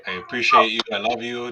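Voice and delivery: ordinary talk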